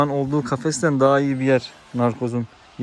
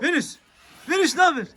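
much higher, cheery greeting to the dog; pet greeting. Real, not acted.

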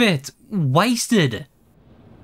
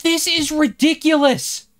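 angry rant; 9 semitones higher than usual. Real, not acted. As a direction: spit it out in fury. Gamer ranting after dying.

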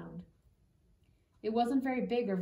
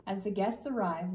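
usual speaking voice

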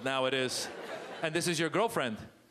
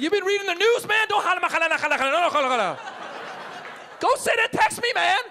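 much higher, loud panicked scolding; frantic shout; 13 semitones higher, 7 dB louder than usual. Retold or imitated. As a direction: yell at your friend to shut up. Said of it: "I'd be like".

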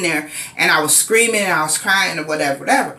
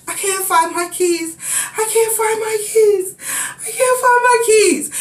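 rising higher and more desperate, near crying; panicked plea, pitch climbing each time; frantic panic; 13 semitones higher than usual. Retold or imitated. Woman trapped inside, pleading with police, frantic high cry. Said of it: "I'm so frantic".

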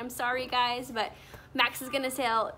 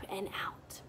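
breathed out; 4 semitones lower, 10 dB quieter than usual. Shown as a demonstration. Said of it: "take our deep breaths and go in".

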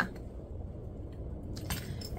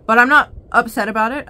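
gleeful; 3 semitones higher, 11 dB louder, faster than usual. Real, not acted. Happy about an accidental purchase.

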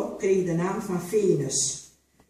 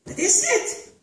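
much higher, loud startled cry; shocked outcry; 6 dB louder, slower than usual. A narrator's reading. The mistress cries out at the defiant slave, shocked. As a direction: recoil in outrage. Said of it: "riep ze".

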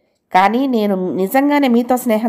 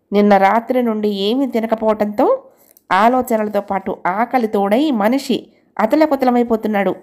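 plain reading voice

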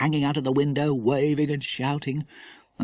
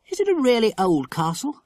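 lightly voiced girl, higher pitch; higher, lightly acted child's question; curious girl; 9 semitones higher than usual. A narrator's reading. Girl asks eagerly about castle.

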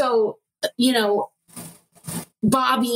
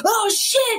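high-pitched startled cry; mock scream; 13 semitones higher than usual. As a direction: imitate a terrified yelp without much volume. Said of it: "He screams".

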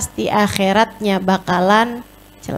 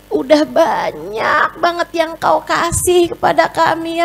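higher, pleading voice of shame; ashamed tearful prayer; 7 semitones higher, slower than usual. Acted. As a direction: plead to God, ashamed of yourself. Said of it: "Nangis karena Allah tuh". (doa malu dengan suara tinggi memelas)

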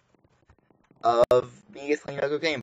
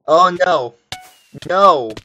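loud, higher cry of dismay; dismayed outcry; 5 semitones higher, 11 dB louder, much slower than usual. Real, not acted. Calling out as game goes wrong.

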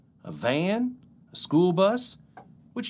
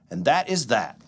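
bouncy, sing-song picture-book reading; lively storybook read-aloud; reading to children. Acted. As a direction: read it aloud like a bedtime story for little kids. Reads picture book to kids.

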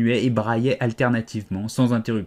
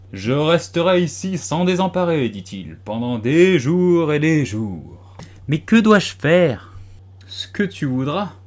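higher, drawn-out footman voice; obstinate footman; 5 semitones higher, 5 dB louder, slower than usual. A narrator's reading. Footman answering Alice that he will stay for days, obstinate. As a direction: stretch it out, loftily indifferent.

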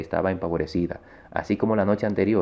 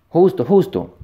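high, loud call of warning; urgent high-pitched yell; warning shout; 10 semitones higher, 8 dB louder than usual. A narrator's reading. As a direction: cry out the name to warn a friend. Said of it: "grité con la pretensión de advertirle".

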